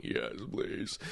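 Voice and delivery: breathless whispered yes please; mock pleading whisper. To the woman offering help, a whispered plea. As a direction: beg for air in a faint whisper. Desperate plea when asked.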